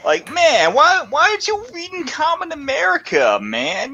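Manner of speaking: badly faked impression of a griping cartoonist pal; George. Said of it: "your George impression sucks".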